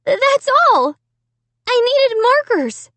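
high, pleading protest; trembling cry. A narrator's reading. Accused girl justifying herself, trembling cry. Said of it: "cried in a trembling voice".

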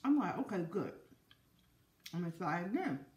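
chewing between words; eating while talking; mouth full of corn. Real, not acted. Seen 0: Bites corn at -4 to -1; lips work, closing between words, while speaking.

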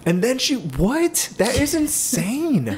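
high, drawn-out incredulous outburst; mock disbelief. Husband reacting to the rearranged dishwasher, mock disbelief. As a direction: stretch it out high in exaggerated disbelief.

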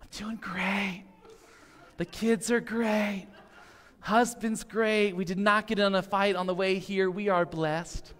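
fake cheerful relative. Pastor mimics a relative at Thanksgiving, falsely cheerful. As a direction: play the relative who insists all is great. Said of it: "you can see that one aunt or uncle".

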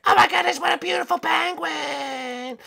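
high cartoon princess, delighted; admiring princess. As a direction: coo over a pet in a princess voice.

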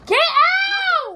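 high, loud yell close to crying; tearful angry shout. Real, not acted. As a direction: scream them out of the room. Yelling, near tears.

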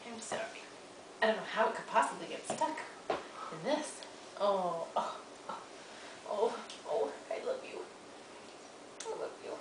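hushed comforting voice; soft soothing. Real, not acted. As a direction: soothe her gently, very softly. Soothing stuck baby.

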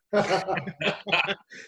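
bright rising laugh at his own punchline. Real, not acted. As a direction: let a real laugh break out, rising.

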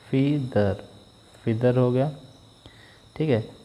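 ordinary talk

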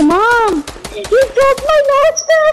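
high, slow mocking whine of the kid; mock the kid whining to his mom; whiny kid quote. Imitating EJ whining that his launch pad broke. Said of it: "and then he's like".

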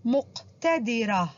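مقتدرة slow, with a firm qaf, muq-tadirah; syllable-by-syllable مقتدرة, qaf clearly articulated; stress the qaf. Shown as a demonstration. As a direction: model the letter ق in مقتدرة. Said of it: "حرف القاف".

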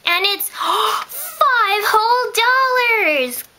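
gasping, slow, high mock amazement; hammed-up wonder at a tiny gift; mock awe. Mock awe at five dollars.

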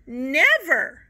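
loud cry of refusal; a loud, firm never; cried out. Acted. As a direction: cry it out in protest. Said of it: "Missus Toggle cried".

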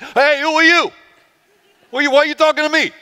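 put-on high-pitched, brash New York accent. Callous woman challenging Jesus at the well, New York accent. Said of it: "like, a New York accent".

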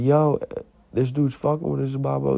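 high-pitched urgent appeal to dad; retold agitated call for backup; acted urgent complaint. Acts out alerting his dad.

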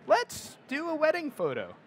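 high, bright-eyed pitch of an idea; a high, playful let's-do-it call; playful suggestion. Retold or imitated. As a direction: toss out the idea with a grin. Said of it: "I was just like".

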